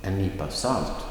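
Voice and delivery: salt lifted above En nypa; stress on salt. Shown as a demonstration. Teacher models an example phrase, stress on salt. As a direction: lean on the last word, salt. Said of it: "the stress is on the last word".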